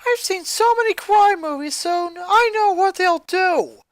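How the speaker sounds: put-on a scared kid spiralling into panic; frantic high girl. Twin scolding her sister in a frantic panic.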